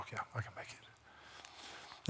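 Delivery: soft slow whisper to himself; whispered thought. Retold or imitated. Pastor thinking to himself, slow whisper. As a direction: breathe the thought almost silently.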